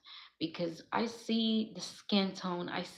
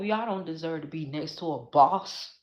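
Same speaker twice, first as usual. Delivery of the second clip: normal talking voice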